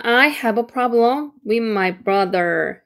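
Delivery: very slow, each word clear; slow model sentence; slow careful read. Shown as a demonstration. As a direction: read it slowly for learners. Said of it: "한 번 읽으니까".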